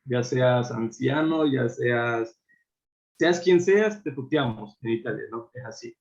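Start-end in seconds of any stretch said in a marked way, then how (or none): none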